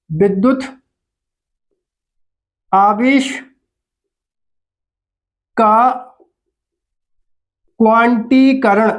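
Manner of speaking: "विद्युत … आवेश … का … क्वांटीकरण" with long pauses between words; word-by-word dictation. Shown as a demonstration. Teacher dictating "विद्युत आवेश का क्वांटीकरण" to pupils, long gaps between words.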